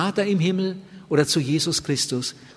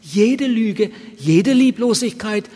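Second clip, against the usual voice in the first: raised, heartfelt praying voice; lightly performed sample prayer, pitched higher; earnest prayer; 4 semitones higher than usual. Shown as a demonstration. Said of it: "Ich darf ihm sagen".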